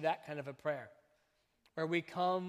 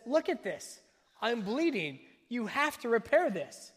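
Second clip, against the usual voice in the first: put-on higher, louder hurt-kid protest; exaggerated indignant child; 7 semitones higher, 5 dB louder than usual. Bleeding kid running in to complain to a parent, exaggerated and indignant. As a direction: act out a child's overblown complaint, voice raised. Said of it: "with some exaggerated speech".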